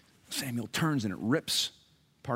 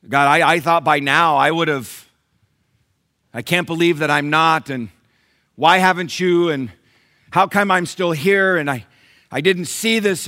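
loud, high, halting frustration; impatient complaint to God; 7 semitones higher, 13 dB louder than usual. Acted. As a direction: sputter out your grievances. Voicing impatient prayer to God.

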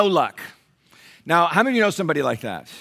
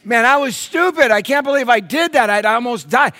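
mock self-reproach; 8 semitones higher, 5 dB louder than usual. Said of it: "You're like".

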